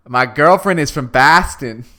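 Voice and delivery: loud, slow mock Boston accent. Shown as a demonstration. Co-host demonstrating his worst Boston accent, loud and slow. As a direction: drag out My girlfriend is from Boston in a bad Boston accent. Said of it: "you have to read it like this".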